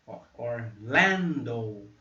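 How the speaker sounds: Orlando very slow, stressed on lan. Shown as a demonstration. Teacher breaking down Orlando, very slow. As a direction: draw out Ó, Orlando syllable by syllable. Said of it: "A sílaba forte está aqui".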